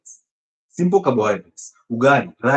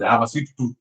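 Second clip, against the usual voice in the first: mocking quote; 4 semitones lower than usual. Doctor mimicking patients' sweet tooth excuse, mocking. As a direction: parrot the excuse with light scorn. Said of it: "these are labels that people use".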